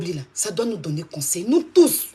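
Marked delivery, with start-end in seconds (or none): 1.4-2.1 emphatic call. Real, not acted. Streamer rallying all her viewers, "Nous tous" called out loud.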